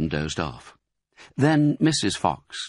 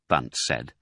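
usual speaking voice

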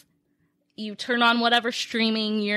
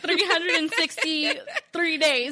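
laughing; 6 semitones higher than usual. Retold or imitated. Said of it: "I'm like".

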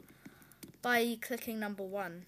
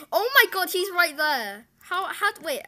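sudden loud alarm; startled gamer yelling out; panicking gamer; 5 semitones higher, 9 dB louder than usual. Real, not acted. Enemy suddenly spotted in game.

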